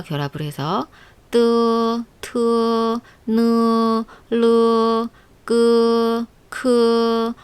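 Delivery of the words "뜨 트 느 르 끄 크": 뜨 트 느 르 끄 크 with a squeezed 으 vowel; Chinese initials drill. Shown as a demonstration. Teacher modeling squeezed initials for learners. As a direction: squash each syllable, 뜨 not 더. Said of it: "흐라고 발음해요".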